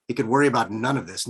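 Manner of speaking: ordinary delivery, nothing marked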